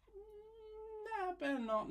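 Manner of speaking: very high, playful hum then refusal; squeaky silly voice. Acted. Artist arguing with herself about deleting a layer, squeaky. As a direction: tease herself in a cartoon squeak.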